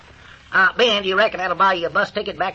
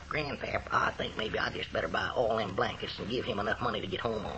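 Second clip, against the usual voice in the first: quiet aside, much softer; hushed aside; 8 semitones lower, 11 dB quieter than usual. Acted. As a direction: murmur it privately to grandpa.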